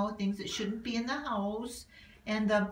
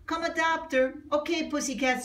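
excited; 7 semitones higher, 3 dB louder than usual. Acted.

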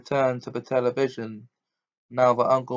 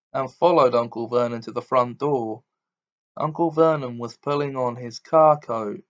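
plain reading voice

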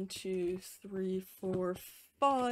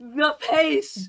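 excited; 6 semitones higher, 8 dB louder than usual. Real, not acted. Cheering the runner's pace.

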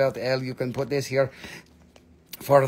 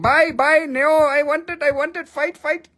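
put-on high, pleading kid-like voice grabbing for it; whiny greedy buyer; 10 semitones higher, 4 dB louder than usual. Seller mocks buyers fighting over items, whiny high voice. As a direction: beg like a child who wants the toy. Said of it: "Just keep pushing the button and be like".

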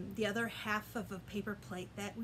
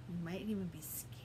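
scared whisper; 5 semitones lower, 5 dB quieter, faster than usual. Shown as a demonstration. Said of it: "show me scared face".

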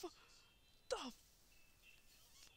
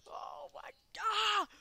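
slow, loud, higher shout of shock; 7 semitones higher, 8 dB louder, slower than usual. Real, not acted. Gamer reacting to a gruesome game moment, a shocked shout. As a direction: yell it out in disbelief.

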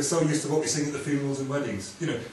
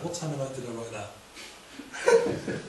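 laughing aside; much slower than usual. Real, not acted. Laughs at own wording.

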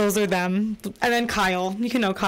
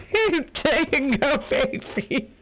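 laughing put-on voice; 6 semitones higher, slower than usual.

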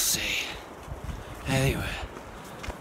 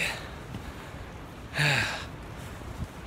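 long tired sigh; 9 dB quieter than usual. Real, not acted. Exhausted after steep climb.

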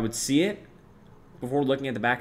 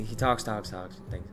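normal talking voice

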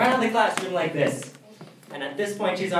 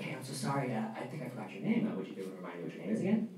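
much softer, very fast; softer, rushed, leaning close to a classmate; hushed apology; 5 semitones lower, 11 dB quieter, much faster than usual. Retold or imitated. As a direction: lean in and murmur quickly. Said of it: "so I just leaned closer".

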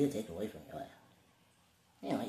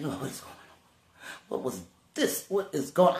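retold tearful plea, rising to a higher, louder cry; 4 semitones higher, 3 dB louder, slower than usual. Man recounts crying out to the Lord, tearful plea. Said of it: "I'm crying and everything".

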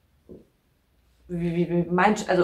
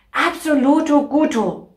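playful excited; 6 semitones higher, 7 dB louder than usual. Acted. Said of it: "playful repeated phrase".

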